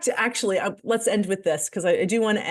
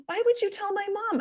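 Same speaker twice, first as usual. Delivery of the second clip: put-on high pleading child voice; whiny kid; 10 semitones higher, 5 dB quieter than usual. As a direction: plead like an alarmed seven-year-old. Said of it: "the oldest one goes".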